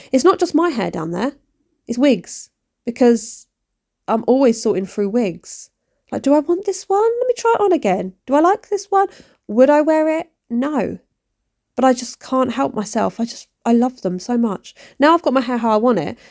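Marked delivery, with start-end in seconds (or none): 6.1-11.0 high, bright musing voice, way up in pitch; self-talk while choosing. Retold or imitated. Woman trying on wigs, asking herself aloud in a high voice. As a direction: voice jumps way up, mock asking herself about each wig. Acting out indecision over wigs.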